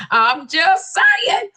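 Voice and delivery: high, slow, drawn-out protest; emphatic disclaimer. Acted. Host repeats own excuse, slow high protest.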